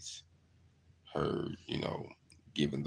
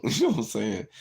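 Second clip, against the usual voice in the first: laughing; 8 semitones higher, 8 dB louder than usual. Real, not acted. Laughs at own story.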